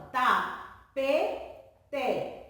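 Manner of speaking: "tapete" very slowly as ta, pe, te; syllable by syllable. Shown as a demonstration. Teacher showing you hear it in speech, "tapete" spaced as ta-pe-te. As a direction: put a clear gap between the syllables of "tapete". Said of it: "até quando mesmo eu estou falando".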